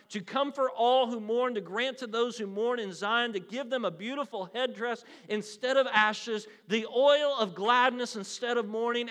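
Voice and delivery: rising fervent sermon proclamation; preaching at full force. Acted. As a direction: proclaim it to the back row.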